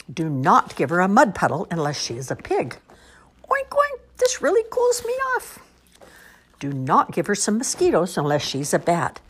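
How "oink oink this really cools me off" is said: very high squealy pig. Acted. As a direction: squeal it like a piglet.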